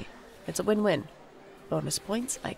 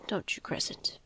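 soft teasing whisper to the cat; 5 dB quieter than usual. Acted. Whispering to the cat, teasing it for wanting attention.

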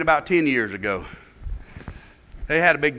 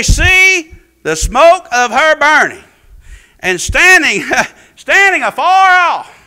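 reading scripture at full preaching force, loud and raised; 9 semitones higher, 12 dB louder than usual. Acted. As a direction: proclaim the verses like a wailing prophecy.

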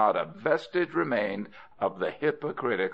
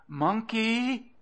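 high, drawn-out coaxing call; would-be soft call; 13 semitones higher than usual. A narrator's reading. Crocodile calling up the tree to Monkey, would-be soft. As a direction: call up sweetly in a high put-on voice. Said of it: "in the softest voice he could manage".

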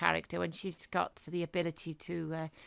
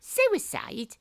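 sharp high disbelief on suicide, then wry; incredulous; 5 semitones higher, 4 dB louder than usual. Acted. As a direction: bark the word back in disbelief. Detective incredulous at widow's claim.

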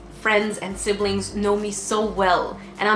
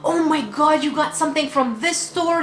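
higher, excited gushing over the brand; imitating a thrilled friend, pitched up; 6 semitones higher than usual. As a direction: squeal with delight over the gift.

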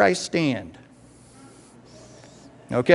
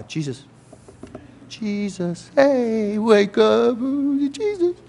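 hesitant disciple trying to rouse a sleeper; tentative waking call; 5 semitones higher, slower than usual. Acted. Disciple trying to wake sleeping Jesus, tentative and rising in pitch.

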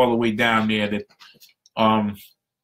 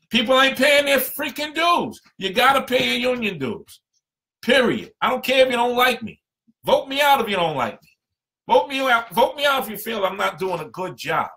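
fired up, higher and pushing hard; passionate; 10 semitones higher than usual. Real, not acted. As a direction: preach it like you mean it. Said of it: "I know I'm going a little passionate today".

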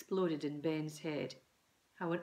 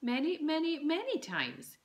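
mum's accusing voice; 9 semitones higher, 4 dB louder than usual. A narrator's reading.

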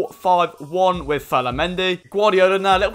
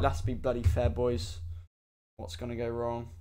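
quiet dread; 6 semitones lower, 12 dB quieter than usual. Real, not acted. About to drink soap.

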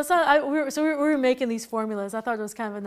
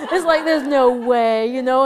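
defiant laugh; 12 dB louder than usual. Real, not acted. Teacher refusing to let the truck cut in, loud laughing defiance. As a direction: stand your ground, laughing.

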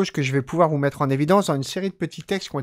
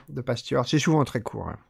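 normal talking voice

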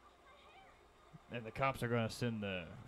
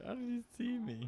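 much higher, squeaky, puzzled quote; 13 semitones higher than usual. Retold or imitated. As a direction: squeak it out like the character. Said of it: "that was a good part".

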